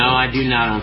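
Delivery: ordinary talk, nothing marked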